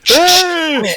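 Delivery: very high, loud drawn-out cry; distressed character calling. Acted. Game master as trapped man calling out, high wail. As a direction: call out in a high wailing voice.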